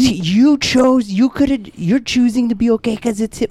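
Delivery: high-pitched, incredulous accusation; Oli. Retold or imitated. Passenger analyzing the driver's sudden calm, high and incredulous. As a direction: imitate someone gleefully catching a hypocrite out. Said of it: "She goes".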